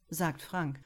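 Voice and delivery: ordinary delivery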